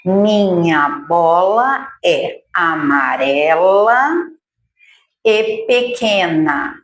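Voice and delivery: slow sentence with each word set apart; slow dictation. Shown as a demonstration. Teacher dictating a new sentence slowly for children. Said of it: "Vamos lá".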